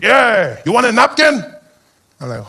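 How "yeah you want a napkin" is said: high, loud order call; taco vendor. Acted. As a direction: sing out the order. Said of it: "He's like".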